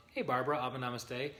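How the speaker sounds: usual speaking voice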